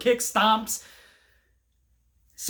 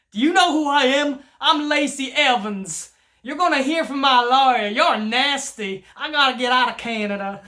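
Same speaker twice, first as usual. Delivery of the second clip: bad Lacey Evans accent; 4 semitones higher than usual. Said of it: "Sorry about the bad accent".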